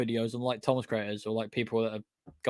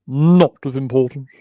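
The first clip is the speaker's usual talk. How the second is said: louder, theatrical mock character voice; silly put-on voice; 8 dB louder than usual. Dismissing James as not on the list, silly voice. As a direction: repeat it as a hammed-up catchphrase. Repeats own phrase in silly voice.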